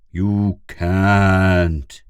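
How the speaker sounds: loud, drawn-out words; very slow explaining. A narrator's reading. Pid explains as to a grumling, very slowly. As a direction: spell it out as to a youngster. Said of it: "very slowly, as one would explain to a grumling".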